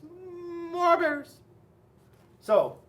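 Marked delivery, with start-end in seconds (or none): 0.0-1.4 put-on high childlike cry; high-pitched kids' refrain; children calling out. A narrator's reading. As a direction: call out like demanding boys and girls. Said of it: "all they would say was".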